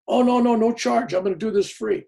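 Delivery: high, loud, overeager promise of free; eager fake priest. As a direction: imitate the priest swearing it costs nothing.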